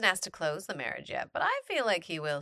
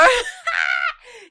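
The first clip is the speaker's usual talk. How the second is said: loud laugh; 12 dB louder than usual. Real, not acted. Laughing after rereading.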